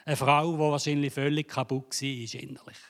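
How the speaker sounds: usual speaking voice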